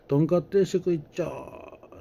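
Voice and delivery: high call-out of とんかつ定食 to the kitchen; calling out an order. Retold or imitated. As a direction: sing out とんかつ定食 so the kitchen hears.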